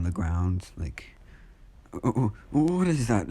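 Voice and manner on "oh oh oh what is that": high, stammered alarm; mock panic. Bakugo sees Death behind Izuku, panicked. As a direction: act out a frightened outburst.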